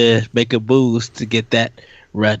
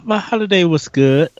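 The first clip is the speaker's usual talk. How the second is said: ordinary talk, nothing marked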